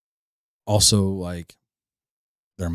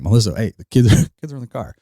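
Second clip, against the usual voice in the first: louder interjection with a laugh in it; urgent mock warning; 4 semitones higher, 6 dB louder, faster than usual. Parent warning ex that kids are in the car, louder. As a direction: cut in quickly to stop the swearing. Cutting off swearing ex on phone.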